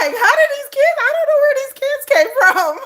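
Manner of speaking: high, loud incredulous squeal; baffled disbelief. Retold or imitated. Mom retelling her pregnancy shock, squealing high in disbelief. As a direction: act stunned about where the kids came from. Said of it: "'cause I'm like".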